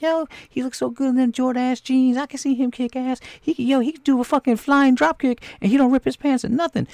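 wife gushing to her husband, in a high mock swoon. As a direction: gush like a wife crushing on Chuck Norris. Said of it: "She's like".

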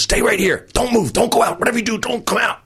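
imitating urgent, high-pitched commands; urgent orders. Booker hides comic in the dressing room, urgent. Acts out booker hiding him away.

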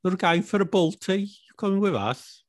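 high-pitched mock Birmingham accent. Inviting friends out for a Baltie, in a put-on Birmingham accent. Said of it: "It's better than my Cockney accent".

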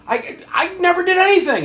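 high, loud, stammered protest; angry denial. Retold or imitated. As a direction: protest your innocence, voice leaping up in mock outrage. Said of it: "he's aggravated, he's angry, he's denying it".

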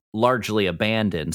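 normal talking voice

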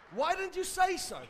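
high-pitched, comic change of tune; mock-innocent, lilting question; sudden friendliness. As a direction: act suddenly agreeable to the caller. Retold sudden change toward caller.

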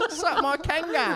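high, loud, slow joke taunt; hammed-up heckler. Storyteller parodying his own insult, hammed-up heckler voice. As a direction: draw out the silly insult while laughing.

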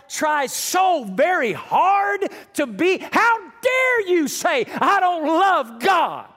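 slow, loud, high indignant shout; angry retort. Retold or imitated. Pastor reliving his angry outburst at Clyde, shouting. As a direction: hurl it back at him, stung and furious. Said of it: "I got angry".

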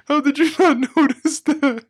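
high laughing disbelief. Real, not acted. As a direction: laugh through the question. Laughing at friend.

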